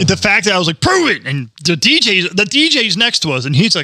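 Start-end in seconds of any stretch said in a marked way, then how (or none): 0.8-1.2 cocky dare. Retold or imitated. Retelling the dare.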